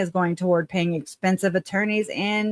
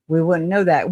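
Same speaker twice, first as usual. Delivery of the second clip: ordinary delivery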